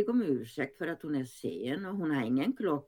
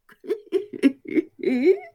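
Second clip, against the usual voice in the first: high loud laughing fit; burst of laughter; 9 dB louder than usual. Acted. The two friends cracking up on the bench, loud laughter. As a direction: let a big shrieking laugh burst out. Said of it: "Hon brister ut i skratt".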